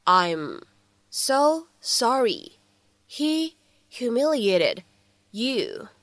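slow, a pause after each word or two. Shown as a demonstration. Teacher breaking down the film line for learners, word by word. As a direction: dictate it in small separate pieces.

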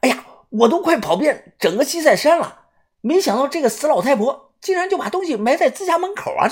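blurting out the find with glee; excited. A narrator's reading. Douya bursting out to his partner, excited and high. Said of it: "豆芽仔一看也激动了起来".

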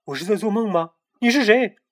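high, rising frightened questions; scared and disbelieving. A narrator's reading. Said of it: "小胖墩儿惶恐地问". (惶恐地发问)

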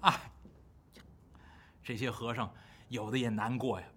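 ordinary delivery

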